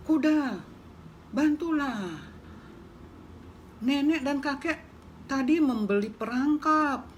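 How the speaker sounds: high, urgent little mouse plea; pleading mouse. Acted. Mouse pleading with the horse about the trap, high and urgent.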